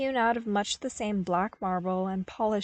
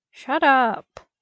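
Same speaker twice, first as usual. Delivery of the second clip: a short, louder grumbling snap; grousing; 5 semitones higher, 8 dB louder than usual. A narrator's reading. Luke grousing at the snickering commanders, louder and grumbling. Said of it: "he groused".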